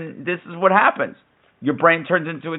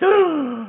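excited gasp; 13 semitones higher than usual. Retold or imitated. Gasp at chav fight.

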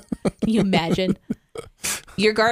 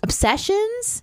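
puzzled question; 13 semitones higher, slower than usual. Real, not acted. Questioning the proposed theme.

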